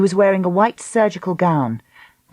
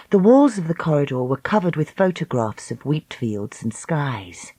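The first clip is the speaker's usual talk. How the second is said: ordinary delivery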